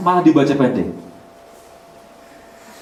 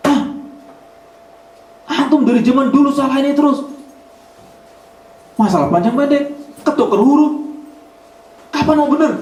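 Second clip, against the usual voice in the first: retold scolding; 13 semitones higher than usual. Guru ngaji scolding his student, exasperated high scold. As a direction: scold a stubborn student, fed up. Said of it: "guru ngaji saya bilang".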